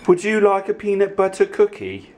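high, playful question; high-voiced offer. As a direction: pitch the offer way up. Asks and answers own question.

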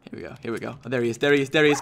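ordinary delivery

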